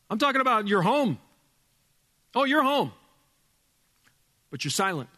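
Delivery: normal talking voice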